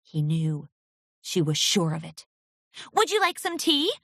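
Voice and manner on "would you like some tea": nervous shaky offer; wavering voice. Acted. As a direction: let the voice waver. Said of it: "her voice wavering".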